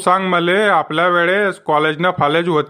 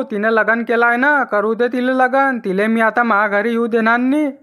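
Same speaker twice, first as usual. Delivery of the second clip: ordinary delivery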